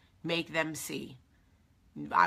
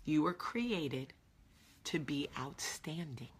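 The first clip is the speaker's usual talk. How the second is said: soft slow emphasis; 3 semitones lower, 7 dB quieter, slower than usual. Acted.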